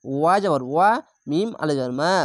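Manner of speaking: normal talking voice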